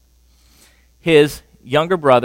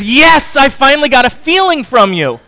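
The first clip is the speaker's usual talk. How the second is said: much higher and louder, exultant; triumphant cry; 10 semitones higher, 8 dB louder than usual. Retold or imitated. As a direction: celebrate the breakthrough. Wife triumphant at his reaction.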